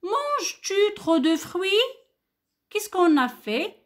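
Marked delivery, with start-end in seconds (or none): 0.0-1.7 "Manges-tu trop de fruits?" said high with the voice rising; question intonation. Shown as a demonstration. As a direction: ask it clearly, voice going up. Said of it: "regardez avec moi". (intonation interrogative montante)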